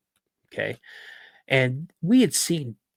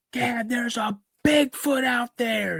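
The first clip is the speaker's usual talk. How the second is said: put-on high-pitched, drawn-out cry of a frightened kid; alarmed kid cry; 13 semitones higher, slower than usual. Boy telling his dad of a Bigfoot outside, alarmed cry. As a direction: call out urgently, stretching the words high.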